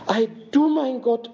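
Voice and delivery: high astonished exclamation of the dwarfs; amazed cry. Acted. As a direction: cry out in wonder, pitched high.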